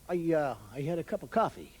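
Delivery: low American small talk; questioning American. Acted. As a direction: hesitantly report it like an American. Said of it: "They say things like".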